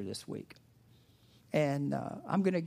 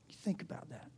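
whispered, much softer; 13 dB quieter than usual. Real, not acted. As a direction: hush it to the congregation. Hushed reflection on scripture.

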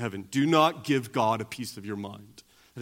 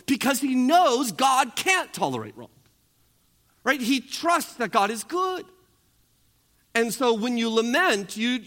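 higher and louder, driving the sermon home; preaching at full force; 8 semitones higher, 4 dB louder than usual. Acted. Pastor preaching hard to his congregation, fiery. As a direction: build the sermon to a fiery peak.

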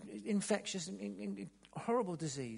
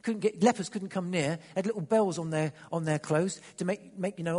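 normal talking voice

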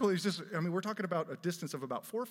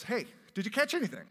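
calling out; 7 semitones higher, 7 dB louder than usual. Acted. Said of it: "he calls out and he goes".

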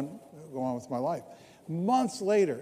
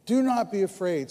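louder, proclaimed; preached scripture; 3 semitones higher, 6 dB louder than usual. Acted. Pastor reading Samuel to the church, proclaimed louder. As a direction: proclaim the verse to the room. Reads Samuel's words in the sermon.

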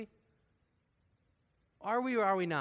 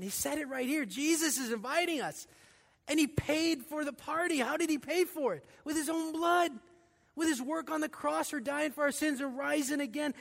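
higher and louder, preaching at full force; fiery preaching; 8 semitones higher, 5 dB louder than usual. Acted. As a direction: drive the gospel home from the pulpit.

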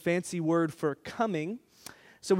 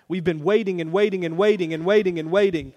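rattled off; 3 dB louder, faster than usual. Acted. Joking about Cowboys fans waiting.

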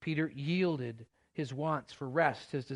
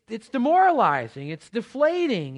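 demoralizing pushed out louder and higher; emphatic; 7 semitones higher, 10 dB louder than usual. Real, not acted. Pastor stressing to the church how deflating it feels, loud and high. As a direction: hit demoralizing hard, voice rising. Building rant about failed days.

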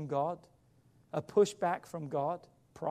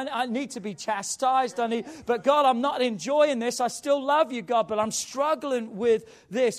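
loud, higher-pitched plea to God; impassioned prayer; 5 semitones higher, 8 dB louder than usual. Acted. Preacher shows congregation how to confess to God, impassioned loud prayer. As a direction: pour out an honest struggle at full voice. Models an honest, struggling prayer.

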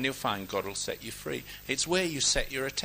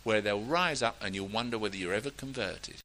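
usual speaking voice

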